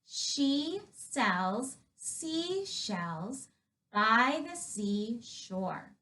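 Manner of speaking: very slow, each word spelled out clearly; slow practice read. Shown as a demonstration. Yoga teacher slowly modeling the tongue twister for kids. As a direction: say it slowly so kids can follow. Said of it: "The first one is".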